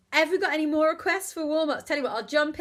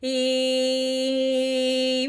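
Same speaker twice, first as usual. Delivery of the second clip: louder buzzy twangy E; 6 dB louder than usual. Shown as a demonstration. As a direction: make the E as twangy as you can. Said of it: "How buzzy can you make it?".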